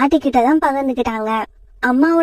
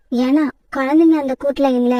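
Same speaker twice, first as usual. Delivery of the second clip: ordinary delivery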